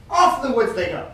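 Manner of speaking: usual speaking voice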